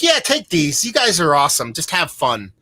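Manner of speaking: high, excited imitation of the bartender handing over beer; generous bartender. Bartender gives them free bottles, voiced high and excited. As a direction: sound like a delighted woman giving gifts away. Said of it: "She's like".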